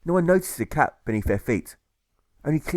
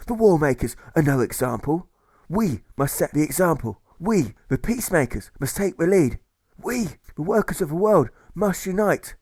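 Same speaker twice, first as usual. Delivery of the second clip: loud, high, rallying oration; rousing protest speech; 7 semitones higher, 3 dB louder than usual. Acted. Orator shouting to the anti-war crowd, rousing. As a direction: whip up the crowd against the war. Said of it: "As he shouts his conclusion".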